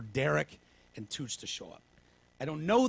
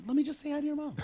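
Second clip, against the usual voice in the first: high, fast mock-sweet voice; mocking patronizing friend; 10 semitones higher, faster than usual. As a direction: imitate him, sing-song and fast. Mocking calm friend's request.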